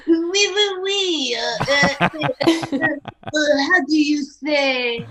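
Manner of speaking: a hammed-up French accent, fumbling for words. Mocking the French boyfriend for Emma, hammed-up French accent. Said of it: "does he sound like this?".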